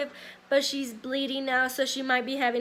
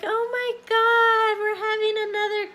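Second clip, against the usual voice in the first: squealing high with delight, quicker in the middle; excited mom; 9 semitones higher, 4 dB louder than usual. Retold or imitated. Mom seeing her grandbaby's ultrasound, squealing with excitement. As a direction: imitate mom thrilled at the ultrasound picture. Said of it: "She was excited".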